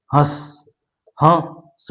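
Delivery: हँस with nasal vowel, quick; chandrabindu demo. Shown as a demonstration. Teacher showing quick nasal हंस. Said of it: "कम समय में और वर्ण के साथ".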